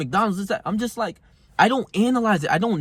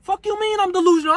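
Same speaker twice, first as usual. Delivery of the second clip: outraged disbelief. Retold or imitated. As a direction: shoot it up in shocked outrage. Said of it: "I'm like".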